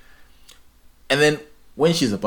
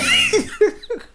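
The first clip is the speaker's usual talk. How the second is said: high squealing laugh; 3 dB louder than usual. Real, not acted. Cracks up recalling it.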